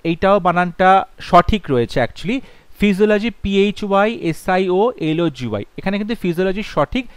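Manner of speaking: ordinary talk, nothing marked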